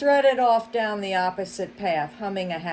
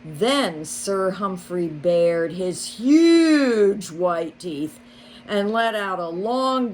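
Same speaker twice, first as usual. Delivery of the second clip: usual speaking voice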